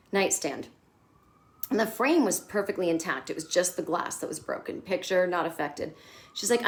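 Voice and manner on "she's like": ordinary delivery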